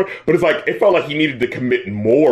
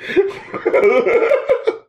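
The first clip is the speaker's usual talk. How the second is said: high breathy laugh. Real, not acted. Cracking up at the piano coda.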